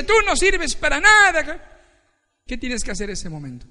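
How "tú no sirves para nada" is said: higher and louder boss yell. Retold or imitated. Grumpy boss yelling at an employee, harsh.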